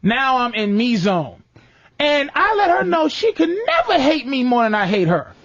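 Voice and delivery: normal talking voice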